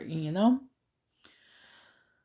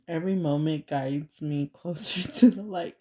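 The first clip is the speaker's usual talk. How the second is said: reading with a laugh breaking through; trying not to laugh; 5 dB louder than usual. Real, not acted. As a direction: read aloud while fighting real giggles. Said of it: "trying not to laugh".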